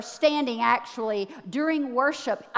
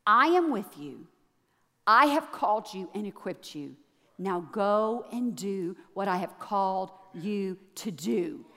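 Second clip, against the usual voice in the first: softer, lower, calm reassuring voice; God's reassuring word; 6 semitones lower, 4 dB quieter than usual. Retold or imitated. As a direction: say it like a quiet divine promise. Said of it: "this is what I felt him say".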